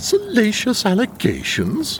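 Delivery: high, scandalised echo question; shocked disbelief. Acted. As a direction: repeat it in affronted disbelief, voice leaping up. Shocked at the allegations.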